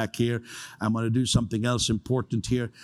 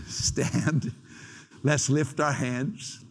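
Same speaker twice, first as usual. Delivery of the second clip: ordinary delivery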